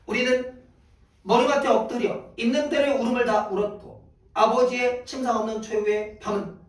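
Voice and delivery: a solemn, lightly heightened poem reading. Acted. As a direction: recite the final scene with weight.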